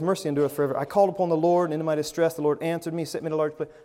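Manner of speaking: rattled-off scripture at a fast clip; hurried, run-on Bible reading; rushed reading. Shown as a demonstration. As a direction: race through the verses without pausing. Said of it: "I read the Bible like that sometimes".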